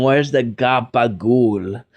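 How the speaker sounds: slow, drawn-out Italian-American "Where's the gabagool?"; Italian-American accent. Retold or imitated. Joking with a guest, an Italian-American accent. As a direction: ask it like a Jersey mobster. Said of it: "You tell them".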